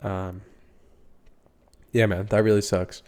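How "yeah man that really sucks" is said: ordinary talk, nothing marked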